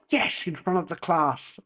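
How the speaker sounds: measured, matter-of-fact teacher's reply; lightly acted stern teacher; unmoved teacher. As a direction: confirm it calmly to a panicking pupil. Teacher answering the horrified pupil.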